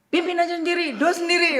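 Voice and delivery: high, loud mock scolding; very sulky mother. Mother refuses to lead prayer, loud and huffy. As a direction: snap back like an exasperated mom. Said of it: "mama saya itu udah ... ngambek banget ya".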